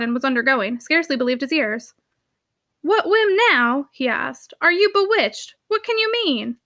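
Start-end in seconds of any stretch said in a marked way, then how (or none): 2.8-3.9 higher, slow incredulous question; exasperated disbelief. Acted. Uncle astonished at his niece's wish to work, exasperated. 4.6-6.6 higher-pitched incredulous questions; exasperated disbelief. Acted. Uncle questioning his niece's odd request, incredulous.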